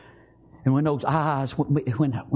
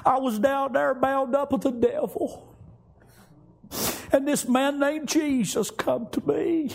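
raised, fervent voice for the wife; wife's testimony; 10 semitones higher than usual. Acted. Wife telling husband her story, raised and fervent. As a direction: pitch her pleading story up high as she pours it out.

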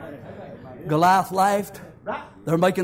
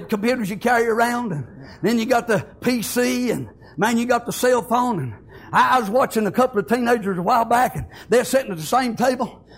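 normal talking voice

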